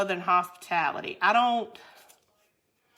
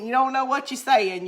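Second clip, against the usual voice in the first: ordinary delivery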